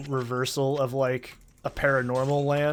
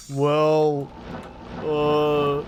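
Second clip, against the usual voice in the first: slow, drawn-out deadpan goodbye; deadpan minion farewell; much slower than usual. Retold or imitated. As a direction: drag out a flat goodbye. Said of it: "he goes".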